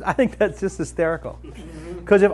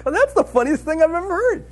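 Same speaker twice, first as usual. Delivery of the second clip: amused; 9 semitones higher than usual. Retold or imitated. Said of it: "I'm like".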